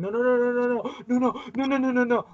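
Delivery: high, loud rapid-fire panic; frantic denial. Retold or imitated. Retelling Captain America losing everybody, frantic. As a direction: imitate someone going crazy with refusal. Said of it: "like, going crazy because he's losing everybody".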